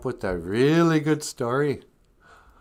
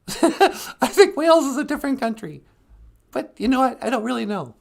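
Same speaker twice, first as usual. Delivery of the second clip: high-pitched, louder character voice; high-voiced sidekick; 4 dB louder than usual. Sidekick explaining Wales to host, high character voice. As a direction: chatter in a very high voice.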